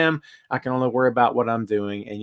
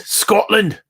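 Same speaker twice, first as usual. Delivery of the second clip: higher-pitched, hammed-up Scottish accent; terrible Scottish accent; 7 semitones higher than usual. Host echoing a viewer's homeland, in a terrible Scottish accent. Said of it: "It's my terrible accent".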